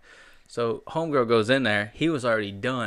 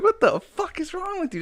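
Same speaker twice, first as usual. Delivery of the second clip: retold outburst.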